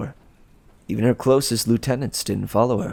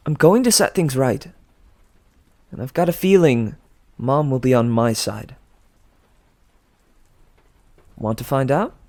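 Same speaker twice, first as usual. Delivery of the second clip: confident lecture; 5 semitones higher, 4 dB louder than usual. Acted. Piper's speech challenging Drew.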